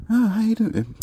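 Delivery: a playful sweet female voice; high girly voice.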